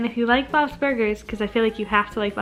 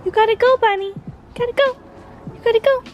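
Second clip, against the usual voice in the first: shooing; 12 semitones higher, much faster than usual. Real, not acted. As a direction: shoo the bunny away. Shooing the bunny.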